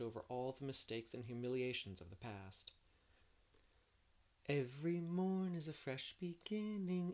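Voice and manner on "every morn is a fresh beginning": put-on higher, bright young girl's voice; hopeful girl. A narrator's reading. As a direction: recite it with fresh hope. Anne quotes verse.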